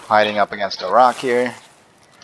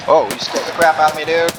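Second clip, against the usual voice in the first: startled; 7 semitones higher, 5 dB louder than usual. Real, not acted. Startled by enemy jump scare.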